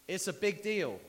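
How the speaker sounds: big deal, called out loud and high; loud emphasis. Real, not acted. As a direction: hit it hard for the room. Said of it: "emphatic repetition".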